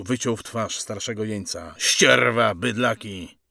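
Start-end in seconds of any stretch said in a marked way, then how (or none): 1.8-3.4 loud spat-out abuse, falling off; sergeant's loud contempt; angry insult. Acted. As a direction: curse the prisoners as you hit out. Sergeant abuses prisoners while striking.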